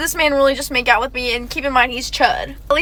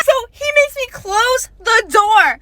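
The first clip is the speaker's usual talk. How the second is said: indignant yell; 6 semitones higher than usual. Retold or imitated. Outraged retelling.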